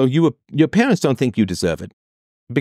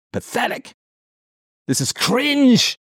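scornful; 12 semitones higher, slower than usual. Real, not acted. Scornful verdict on parents.

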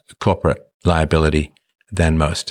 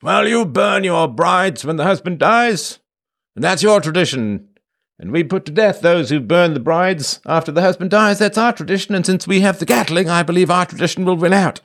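very high, hammed-up pompous British colonial; pompous British imperialist; 12 semitones higher than usual. Host as colonial ruler rebuking locals, pompous British accent. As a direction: declaim like a British empire officer laying down law. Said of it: "I like [name]'s nineteenth century British pompous accent".

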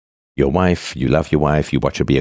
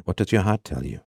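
soft epic question; 7 dB quieter than usual. Acted. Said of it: "have to say it that way".

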